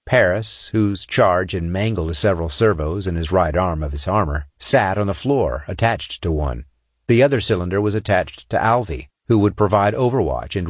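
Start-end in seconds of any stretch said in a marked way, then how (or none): none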